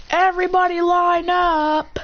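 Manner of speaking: high, drawn-out group summons; calling out. Acted. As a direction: hold each word long on a high pitch.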